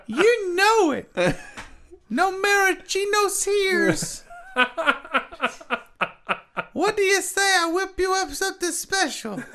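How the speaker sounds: high, slowed-down bartender voice; mixologist character. Pretend. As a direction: play a drawling, high-pitched mixologist. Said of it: "call him a mixologist".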